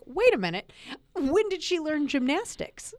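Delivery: high, baffled and laughing; mock disbelief. Reader baffled by the cheerleader twist, high disbelief. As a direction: act out your own double take. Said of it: "And I was like".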